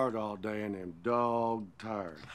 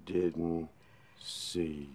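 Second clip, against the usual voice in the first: low menacing threat; 4 semitones lower, much slower than usual. Acted. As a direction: drop the voice low and dangerous, trailing away. Husband threatens wife after cheating.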